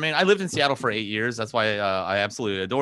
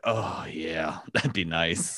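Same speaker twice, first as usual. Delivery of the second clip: low, soft, wistful drinker; glum agreement; 6 semitones lower, 5 dB quieter, much slower than usual. Acted. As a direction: agree quietly, like a depressed barfly. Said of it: "And he'd be like".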